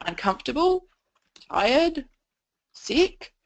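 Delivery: sing-song inflection on each word of the list; over-inflected list, not an objective read; questioning inflection. Shown as a demonstration. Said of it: "saying the words as a question with an inflection in your voice".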